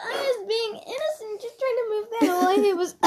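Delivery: high, fast, giggly protest; laughing. Real, not acted. Defending herself to her friend, high and giggling. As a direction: squeak out innocence while laughing.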